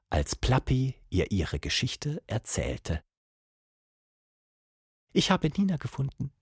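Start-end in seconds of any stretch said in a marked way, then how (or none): 5.1-6.3 timid, high-pitched monkey question; sad monkey. A narrator's reading. Monkey tells her story.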